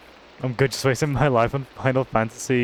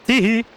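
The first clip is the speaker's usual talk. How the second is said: giggle; 9 semitones higher, 5 dB louder than usual. Real, not acted. Giggles after diamond find.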